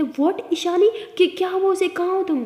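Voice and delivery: startled by bad news, urgent; alarmed. Brother hears sister is hurt.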